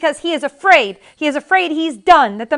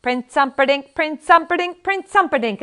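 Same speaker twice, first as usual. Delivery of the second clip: a hint of sing-song needling; taunting; 8 semitones higher, 7 dB louder than usual. A narrator's reading. Valerie taunting Max with the forbidden name, mildly. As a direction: rub the name in his face.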